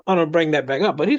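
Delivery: normal talking voice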